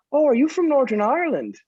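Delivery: high, imitated Northern Irish stranger; stranger asking. Passer-by quizzing the fake Irishman, in imitated Northern Irish accent. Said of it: "he comes up and he's like".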